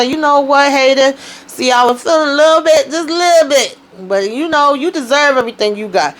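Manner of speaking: high sing-song put-on sympathy; mock sympathy. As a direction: fake pity, then twist the knife. Speaker's own taunting line.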